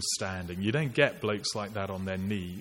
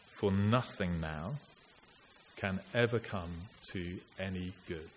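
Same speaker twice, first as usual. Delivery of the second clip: poem reading. Acted. Reads Auden poem aloud.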